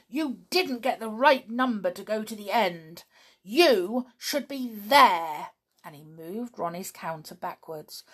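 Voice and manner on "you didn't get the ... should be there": angry shout. A narrator's reading. Donald tells Ronnie off for cheating, angry and loud. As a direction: scold him hard. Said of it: "he shouted to Ronnie".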